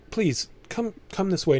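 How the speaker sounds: woman ushering guests. Acted. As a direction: usher them in with a high, lifted voice. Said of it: "this woman says".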